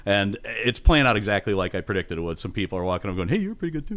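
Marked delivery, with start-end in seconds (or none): 3.3-4.0 high-pitched mock fan voice; put-on, high fan chirp; gushing passerby. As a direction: imitate a fan sidling up with faint praise. Acting out fans walking up.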